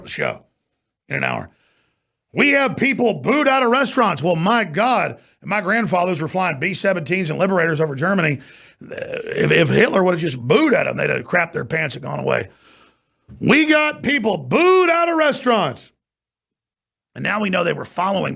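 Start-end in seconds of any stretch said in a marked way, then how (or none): 2.3-4.2 high-pitched mocking complaint; mock outrage. Host mocking people upset at being booed out of restaurants, mock outrage. 13.4-15.9 very high, drawn-out mocking wail; exaggerated mock outrage. Host ridiculing the booed-out-of-restaurants complaint, hammed-up mock outrage. Repeats the mocked complaint, bigger.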